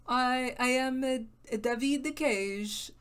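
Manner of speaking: a bad French accent that turns Italian; mock accent slipping Italian. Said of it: "It's slipping Italian again".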